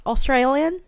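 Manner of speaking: Australian, rising, in an exaggerated Australian accent; mock Australian accent. Shown as a demonstration. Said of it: "Australian accent".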